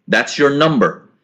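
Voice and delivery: usual speaking voice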